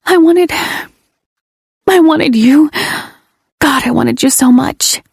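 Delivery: high, pleading admission; desperate confession. Acted. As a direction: break into longing. Confession at rant's end.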